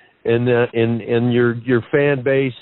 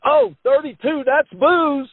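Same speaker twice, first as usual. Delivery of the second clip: much higher playful dad voice; joking dad; 10 semitones higher than usual. Retold or imitated. Son imitating dad's bingo jokes at the RV park, high playful. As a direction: toss off the bingo joke like his dad. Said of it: "my dad's just sitting there going".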